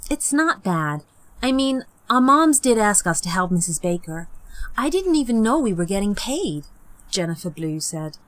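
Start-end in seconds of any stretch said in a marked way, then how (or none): 0.1-6.7 a lightly voiced earnest young girl; mildly hopeful kid voice.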